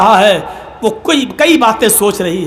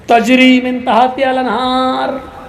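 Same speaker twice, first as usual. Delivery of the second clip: slow Quranic recitation; 2 semitones higher, slower than usual. Acted. Maulvi recites Quranic verse.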